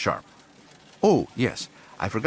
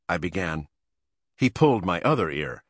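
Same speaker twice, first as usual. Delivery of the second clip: ordinary delivery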